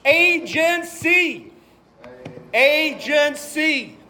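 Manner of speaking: slow, high, scornful drawl on agency; mocking drawl. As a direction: stretch "agency" out like a sneer. Mocking a doctrine.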